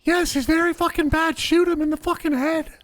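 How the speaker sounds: high falsetto woman's voice, fast and urgent; a man in drag begging to shoot him; panicked fake lady voice. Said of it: "Disguise your voice like a lady?".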